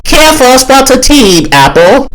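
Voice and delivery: high-pitched mock British accent; Onion with British accent. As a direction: put on a squeaky, polite British voice. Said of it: "act all British and whatnot".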